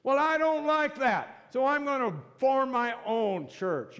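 loud, high-pitched mock indignation; hammed-up defiant outburst; arguing. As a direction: act out a member storming off in protest. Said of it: "Arguing. I've gotta be right".

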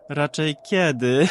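slow, loud, eerie madwoman voice; eerie mocking question; mad woman. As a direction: drag it out like a mad spirit. Mad woman answers teasing.

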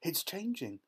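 high, thrilled exclamation without much volume; excited shout. A narrator's reading. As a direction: announce a marvel with delight. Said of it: "shouted Violet".

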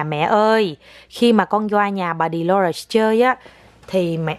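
put-on slow, careful line for the child to copy; slow model sentence. Shown as a demonstration. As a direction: spell it out slowly so she repeats. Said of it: "con phải nói như vậy nè". (mẹ nói chậm từng chữ cho con nói theo)

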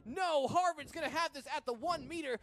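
rising, shouted call of a breakaway; excited play-by-play. Real, not acted. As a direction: yell it as the runner breaks through. Big breakaway run, live call.

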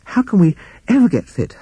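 panting. A narrator's reading. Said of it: "he panted".

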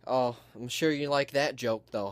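ordinary talk

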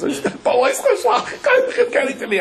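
high, slow, laughing mock complaint; mocking a patient telling his psychologist, high laughing whine; mock whiny patient. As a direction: squeak it out like a silly patient to his therapist, half laughing. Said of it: "coming to a psychologist now and says".

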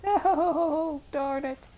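high, loud wails of frustration; frustrated yelling. Real, not acted. Player fed up with the boss, frustrated yelling. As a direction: yell out each curse in real dismay.

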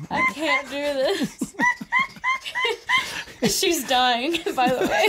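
helpless laughter; cracking up and can't stop; laughing. Real, not acted. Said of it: "She's kinda choking on her tea right now".

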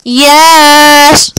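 loud high triumphant yell; shouted yes. Real, not acted. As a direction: yell it out with glee. Buying zombify.

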